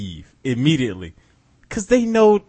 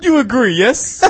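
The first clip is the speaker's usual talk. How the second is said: put-on higher, louder comic Russian accent; bad Russian accent; 7 semitones higher, 4 dB louder than usual. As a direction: press for agreement in mock Russian. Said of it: "That's a terrible Russian accent".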